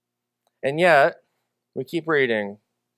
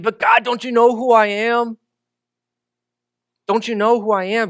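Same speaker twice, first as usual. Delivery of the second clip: protesting doubter; 6 semitones higher than usual. Acted.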